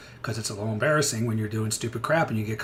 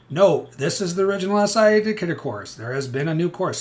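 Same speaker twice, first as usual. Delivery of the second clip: higher, louder, laying down the caveat; emphatic warning; 8 semitones higher, 10 dB louder than usual. Retold or imitated. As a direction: spell out the warning so nobody misses it. Reading out his course caveat.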